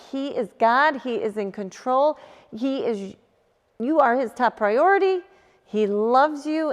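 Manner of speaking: ordinary talk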